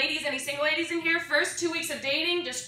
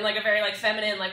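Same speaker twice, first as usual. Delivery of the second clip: ordinary talk, nothing marked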